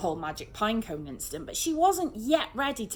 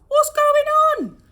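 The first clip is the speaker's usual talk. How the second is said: much higher, louder, slow bellow; exasperated outburst; 15 dB louder, much slower than usual. A narrator's reading. Sergeant demanding answers, loud and high. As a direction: lose patience entirely. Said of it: "baffled sergeant exploding".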